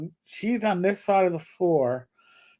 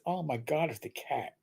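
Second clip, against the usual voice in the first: hushed retold realization; 2 semitones lower, 12 dB quieter than usual. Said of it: "and realize".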